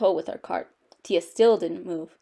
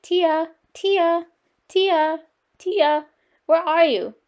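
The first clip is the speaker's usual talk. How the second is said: high, searching call; 6 semitones higher, 4 dB louder, much slower than usual. A narrator's reading. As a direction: call for a lost child down the aisles. Father calling for lost daughter.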